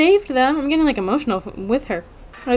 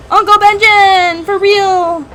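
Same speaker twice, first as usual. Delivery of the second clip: loud, higher, slow joyful call; excited cheer; 6 semitones higher, 6 dB louder, slower than usual. Real, not acted. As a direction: cheer him by name. Cheering at uncle's rescue.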